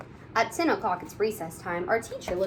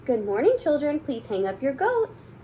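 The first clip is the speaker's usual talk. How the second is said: bright, sing-song teacher greeting; cheery teacher voice; 6 semitones higher than usual. Acted. Teacher greeting her kindergarten class, cheery sing-song.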